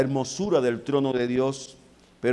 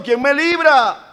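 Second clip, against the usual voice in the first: desperate cry; 12 semitones higher, 9 dB louder than usual. Acted. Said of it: "el profeta está diciendo".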